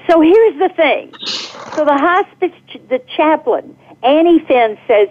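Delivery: normal talking voice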